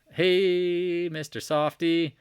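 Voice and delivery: high, loud, stretched greeting; slow, drawn-out teasing call; playful hail. Pretend. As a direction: sing out a long mock hello. Hails the Microsoft chart playfully.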